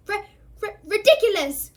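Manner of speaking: put-on frightened boy squeaks the spell out high; squeaked. A narrator's reading. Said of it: "squeaked Neville".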